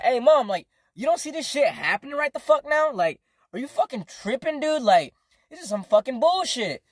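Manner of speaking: high-pitched, exasperated plea to mom; indignant appeal. Retold or imitated.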